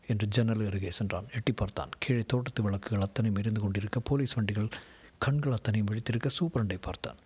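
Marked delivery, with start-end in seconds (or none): none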